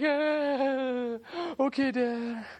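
a hint of a meek son; resigned little boy, lightly voiced; mild little boy voice. Son answering his dad about a spanking.

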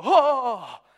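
sudden loud startled Oh; a loud pretend fright; mock panicked gasp. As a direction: yelp in fake alarm at a ripple. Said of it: "is not gonna go".

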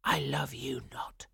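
hushed, whispery echo; misheard rejection. A narrator's reading. Swanhilda hearing rejection in the wheat, hushed. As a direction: breathe it softly like a painful echo. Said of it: "all she heard was".

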